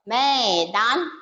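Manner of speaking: मैदान with the ऐ slow and emphasized; teacher stresses the ऐ sound; stressed ऐ. Shown as a demonstration. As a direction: lean hard on the ऐ in मैदान. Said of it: "जोरदार के पढ़ना होता है ऐ की मात्रा पे".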